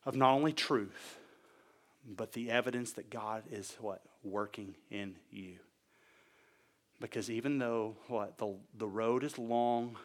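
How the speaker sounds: low, soft preaching with pauses; hushed solemnity. Real, not acted. Hushed, weighty point to congregation.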